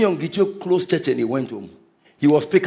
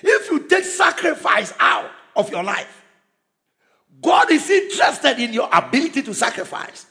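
preaching at full force; 9 semitones higher than usual. Acted. Preacher driving the point of sacrifice home to the congregation, full-force preaching. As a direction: preach it hard, driving each phrase home.

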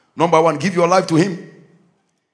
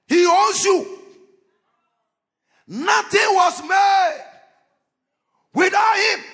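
preaching at full force; 11 semitones higher than usual. Real, not acted. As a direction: proclaim Jesus to the whole church.

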